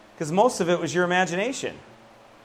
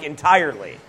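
ordinary talk, nothing marked

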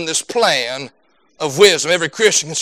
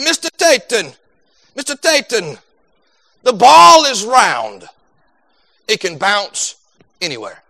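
put-on drawn-out coaching wisdom in a thick Jamaican accent; 3 dB louder than usual. Soccer coach giving a player advice at practice, imitated Jamaican accent. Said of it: "he had that thick Jamaican accent".

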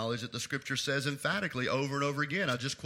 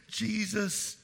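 ordinary talk

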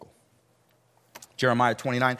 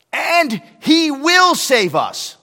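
defiant declaration; 12 semitones higher, 11 dB louder, much slower than usual. Acted. Quoting the three men's defiance.

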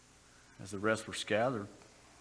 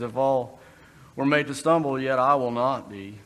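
loud, boastful vow, pitch rising; overconfident, proclaiming it boldly; cocky boast; 2 semitones higher, 8 dB louder than usual. Retold or imitated. Peter boasting to Jesus, loud cocky promise. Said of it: "He's pretty cocky in Mark".

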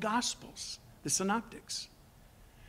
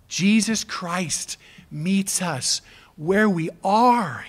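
ordinary talk

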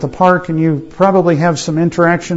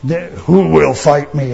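Goliath's voice; 3 semitones lower than usual. Retold or imitated. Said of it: "And he says".

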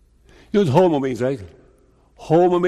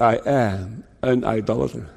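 sinner confessing; 4 semitones lower, 3 dB quieter than usual. Retold or imitated. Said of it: "You say from that".

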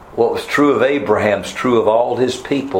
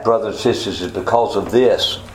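usual speaking voice

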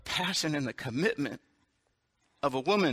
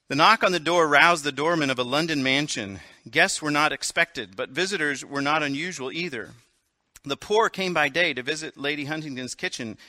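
louder, vivid storytelling; dramatic story narration; 5 dB louder than usual. A narrator's reading. Pastor telling the Countess's story to the congregation. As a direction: read it like an exciting tale.

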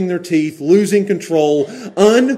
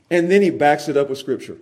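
ordinary talk, nothing marked

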